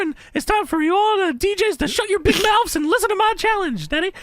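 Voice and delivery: high, brash manager promo voice; shrill gloating wrestling manager. Retold or imitated. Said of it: "On the Mouth of the South".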